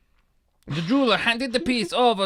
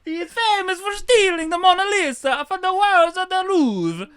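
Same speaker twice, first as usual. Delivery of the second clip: much higher, hammed-up Italian narrator; Italian accent; 11 semitones higher, 3 dB louder than usual. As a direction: ham it up like a stage Italian, laughing through it. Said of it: "I don't know if I can do an Italian accent".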